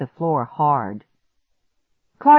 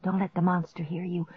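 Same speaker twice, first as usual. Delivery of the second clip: soft, hushed plea; pleading whisper; 9 dB quieter than usual. A narrator's reading. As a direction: hush the dog under your breath. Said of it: "I whispered, petting him".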